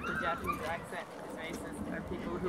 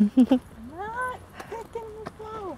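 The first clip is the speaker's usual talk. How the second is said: whiny protest; 14 semitones higher, 8 dB louder, slower than usual. Pretend. Protesting playful accusation.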